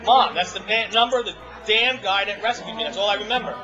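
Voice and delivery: agitated outburst. Retold or imitated. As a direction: snap back at mom in frustration, voice climbing. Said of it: "I'm getting agitated".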